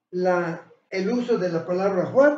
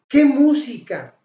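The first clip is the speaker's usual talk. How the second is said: higher, louder, slow admiring exclaim; teacher demos praising music, higher and louder; exclamation demo; 4 semitones higher, 7 dB louder, slower than usual. Shown as a demonstration. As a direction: marvel at the music. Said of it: "Si yo digo".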